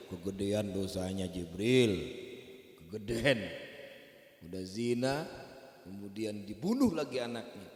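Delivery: Musa justifying. Acted. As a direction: answer Jibril, defending yourself. Musa answers Jibril.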